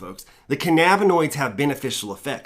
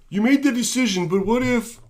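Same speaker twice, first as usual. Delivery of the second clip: high put-on worrying voice; mocking, raised-pitch hypothetical; mock questioner; 7 semitones higher than usual. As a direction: imitate an anxious what-if objector. Mimics questioner worrying about decision.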